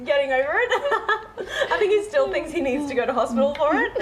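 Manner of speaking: amused, voice lifted by giggles; teasing through laughter; laughing. Real, not acted. Guest laughing while joking about her housemate.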